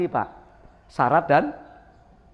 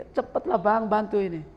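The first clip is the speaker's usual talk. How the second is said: wife calling again; 5 semitones higher than usual. Retold or imitated. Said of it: "teriak lagi".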